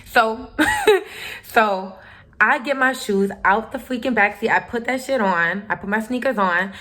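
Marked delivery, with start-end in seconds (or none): 0.5-1.4 sudden high-pitched burst of laughter. Real, not acted. Laughs after story beat.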